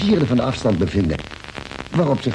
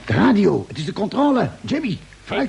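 urgent excited call; 9 semitones higher than usual. Acted. Urgent call to radio operator.

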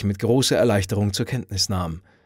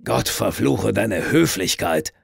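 angry curse; slower than usual. A narrator's reading. Drunk man curses the visitor.

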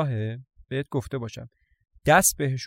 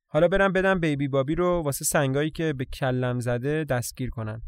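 ordinary delivery

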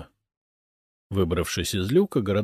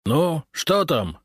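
drawn-out, higher-pitched call; slow, raised questioning call; 8 semitones higher, much slower than usual. A narrator's reading. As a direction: call down into a hole. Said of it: "крикнул ему Прудников".

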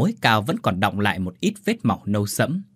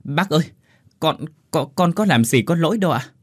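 higher, stuttering protest; stammering in fear; 6 semitones higher than usual. A narrator's reading. Minh, forced to kneel, stammers in fright. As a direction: stammer the words out, startled. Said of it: "miệng lắp bắp".

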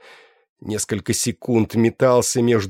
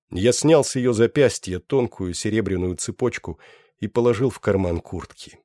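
plain reading voice

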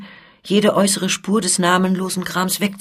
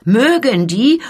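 louder outburst of outrage; indignant cry; 5 semitones higher, 6 dB louder, slower than usual. A narrator's reading. Olivier erupts at Cardillac, loud and indignant. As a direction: let the pent-up anger burst out. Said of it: "rufe ich".

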